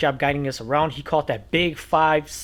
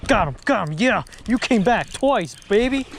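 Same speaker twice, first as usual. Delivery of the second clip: excited; 6 semitones higher than usual. Real, not acted. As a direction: savor it with glee. Thrilled at the catch.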